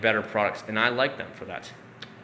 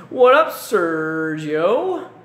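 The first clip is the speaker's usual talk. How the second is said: drawn-out greeting; 4 semitones higher, 6 dB louder, much slower than usual. Real, not acted. Greeting a viewer in chat.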